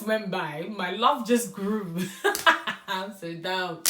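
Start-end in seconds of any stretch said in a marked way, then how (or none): 2.0-2.8 high, loud, breathy burst of laughter; embarrassed laugh. Real, not acted. Laughing at her own naive crush, a loud embarrassed laugh.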